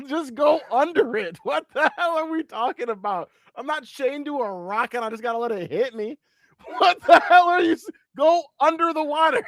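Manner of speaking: high-pitched, laughing disbelief; incredulous protest. Real, not acted. Podcaster disputing deadly waves with cohost, incredulous protest. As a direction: argue it loudly, voice climbing as you go.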